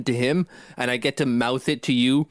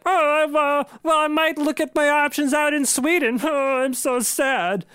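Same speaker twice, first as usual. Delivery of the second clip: high, whiny mock-sad Swede; hammed-up Swedish sob story, pitched way up; 11 semitones higher than usual. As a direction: put on a woeful high Swedish voice. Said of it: "That's my sad Swedish voice".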